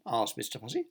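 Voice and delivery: ordinary delivery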